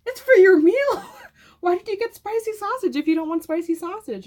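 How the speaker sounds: incredulous half-laughing outburst; exasperated retelling. Retold or imitated. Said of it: "And I was like".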